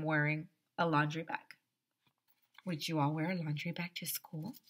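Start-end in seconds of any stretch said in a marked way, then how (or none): none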